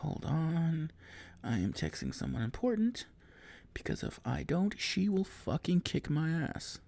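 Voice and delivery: soft, absent-minded delivery while texting; quiet stalling aside; distracted aside. Real, not acted. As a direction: drop the volume, half busy with a phone.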